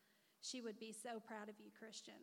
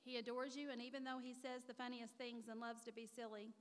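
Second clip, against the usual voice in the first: usual speaking voice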